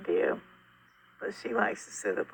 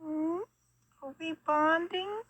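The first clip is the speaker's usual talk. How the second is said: high, tender cooing voice; 9 semitones higher than usual. Real, not acted. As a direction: coo sweetly at the kitten. Cooing to the kitten.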